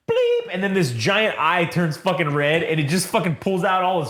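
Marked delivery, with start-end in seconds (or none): 0.1-0.5 very high electronic bleep; imitated robot bleep. Droid switching on, a high bleep. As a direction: squeal out one droid beep. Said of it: "And then it goes".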